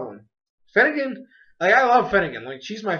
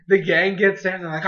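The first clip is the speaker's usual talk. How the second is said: ordinary delivery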